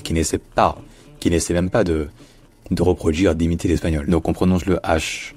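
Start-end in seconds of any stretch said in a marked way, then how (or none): none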